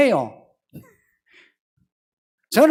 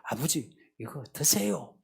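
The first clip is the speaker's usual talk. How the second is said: much softer, low, ingratiating offer; offering a gift; 6 semitones lower, 12 dB quieter than usual. Retold or imitated. Son offering a gift to win over his father. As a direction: hand over a present meekly. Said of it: "선물 사고 해가지고".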